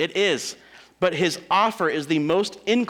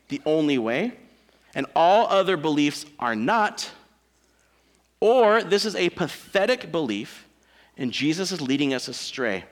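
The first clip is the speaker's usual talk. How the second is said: usual speaking voice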